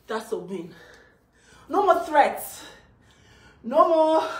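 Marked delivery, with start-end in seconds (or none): 1.7-4.3 loud, raised-pitch defiance; defiant declaration. Real, not acted. Mother declaring the threats over, loud and defiant. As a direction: declare it loudly, each phrase standing alone.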